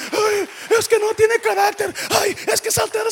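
high, loud, whiny mock complaining voice; mocking complainer. Pastor mimicking church members complaining, whiny. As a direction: mimic a nagging gossip. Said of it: "Esa es la queja número uno".

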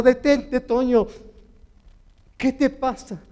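loud, high, emphatic halt; urgent retold command. Bystander stopping the desperate Toño, loud and urgent. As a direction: act out someone forcefully stopping a runner.

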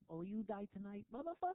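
put-on comic Mexican-accented threat from the dark; mock Mexican accent. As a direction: growl it like a movie-villain Mexican heckler, then spike the insult. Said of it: "that Mexican accent is indecipherable".

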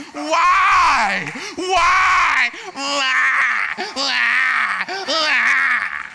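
loud drawn-out mock whine; whining why. Shown as a demonstration. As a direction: whine it like a sulking child. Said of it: "why starts sounding like a whine".

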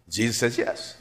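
ordinary delivery

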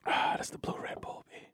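unvoiced hushed whisper. Real, not acted. Savoring the drink, whispered to the mic. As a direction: breathe it like a secret.

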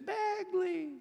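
put-on high squeaky child calling a name; squeaky little voice. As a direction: call the dog in a tiny squeaky voice. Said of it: "this little squeaky voice".

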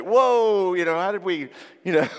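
high and loud startled whoa; hammed-up realisation; mock surprise. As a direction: pull back in staged shock. Shocked at the fight's escalation.

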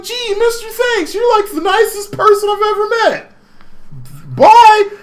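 put-on very high, squeaky little-kid voice, shouted bye; gushing kid. The kid thanking Haseo for the discount, very high and squeaky. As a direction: gush like an overjoyed child. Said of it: "And he's like".

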